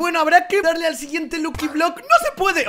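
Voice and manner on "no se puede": much higher startled cry; sudden high exclamation; surprised outburst. Real, not acted. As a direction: caught off guard. Block won't break.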